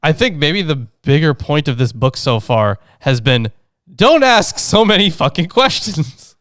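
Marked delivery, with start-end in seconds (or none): 3.9-6.4 higher, slow emphatic telling-off; mock exasperated scolding. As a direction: drag out a mock order, pitched way up. Mock summary of book's message.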